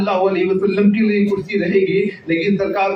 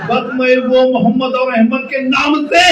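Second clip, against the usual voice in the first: louder, higher sermon cry, voice breaking; impassioned preaching; 5 semitones higher, 5 dB louder than usual. Real, not acted. Preacher voicing God's promise to the crowd, impassioned cry. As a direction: pour it out, shouting and near tears.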